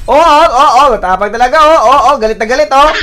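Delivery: high, loud, rising jeers with repeated oh; excited goading. Real, not acted. Gamer remarking on an aggressive opponent, excited goading.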